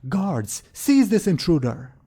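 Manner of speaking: high, loud command to seize an intruder; sudden yell. Acted. Interrupted nobleman orders his guards on the intruder, yelling. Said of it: "he will suddenly yell out".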